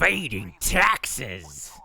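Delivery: slow, drawn-out villain voice; mustache twirling villain. Pretend. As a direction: relish each word like a cartoon villain. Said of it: "it sounds more like Terry".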